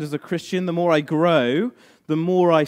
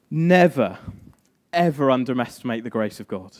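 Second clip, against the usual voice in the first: ordinary talk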